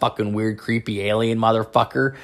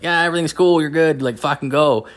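easygoing doctor; 5 semitones higher than usual. Retold or imitated. Voicing the doctor's reassurance.

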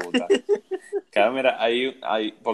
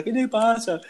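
mock teacher; 12 semitones higher, 4 dB louder, slower than usual. Teacher voiced in a retold story.

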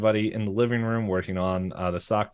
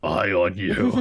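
lower menacing voice; 4 dB louder, slower than usual. Retold or imitated. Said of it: "his voice becomes lower".

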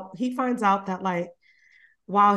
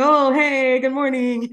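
cheery greeting; 5 semitones higher, 5 dB louder than usual. Retold or imitated. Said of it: "And he's like".

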